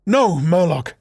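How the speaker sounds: high, loud cutting in; a sister firmly interrupting her brother; firm interruption. Acted. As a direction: cut him off firmly. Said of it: "his sister interrupted him now".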